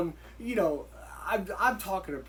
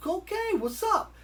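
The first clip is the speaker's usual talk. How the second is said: much higher, slow, drawn-out reaction; pleasantly impressed; 13 semitones higher, slower than usual. Retold or imitated. Boxer watching Rocky throw good punches, pleasantly impressed. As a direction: let the pitch leap as doubt turns to approval. Acts being impressed by the boxing.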